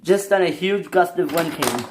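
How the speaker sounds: plain reading voice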